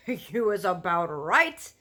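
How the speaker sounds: loud, slow, rumbling giant; big friendly giant warning about cannibal giants; BFG giant voice. Acted. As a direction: boom out the giant's grim warning. Giant's voice in story.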